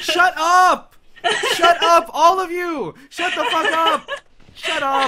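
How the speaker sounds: loud, high shouts over laughter; exasperated yelling. Real, not acted. Player telling laughing friends to stop, exasperated yelling. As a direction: yell to silence everyone who is laughing.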